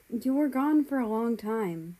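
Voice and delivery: plain reading voice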